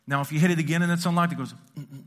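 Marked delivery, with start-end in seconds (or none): 1.8-2.0 a small hummed mm-mm, lighter than the thunk; tiny hummed car beep; little unlock chirp. Shown as a demonstration. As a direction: mimic the little unlock sound. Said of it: "It's the little one".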